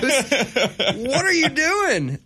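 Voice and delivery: loud, high-pitched fake indignation; put-on scandalised squawk; mock outrage. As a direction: act hugely offended as a joke. Mock outrage at co-host's teasing.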